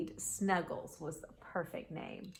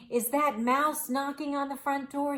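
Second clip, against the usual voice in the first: ordinary delivery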